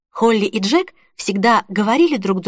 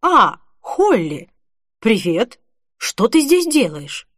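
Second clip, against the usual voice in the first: tense; slower than usual. A narrator's reading. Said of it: "В голосе Ричарда чувствовалось напряжение".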